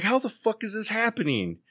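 high-pitched exasperation, not shouted; mock-furious, pitch way up; furious disbelief. Boss watching Dave beside the Pope, incredulous fury.

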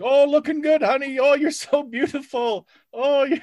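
high, breathy-free cooing admirer; high fawning voice. Retold or imitated. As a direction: coo over her like a creepy fan. Fawning comments under her photos.

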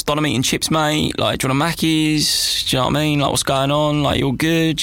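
hammed-up Essex local back home; Essex accent. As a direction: put on matey Essex banter. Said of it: "they'll start speaking like they had never moved away".

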